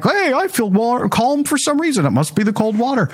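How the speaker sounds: high, airy mock-cheerful voice. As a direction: parody a smug cold-shower fan. Mocking cold-shower fans.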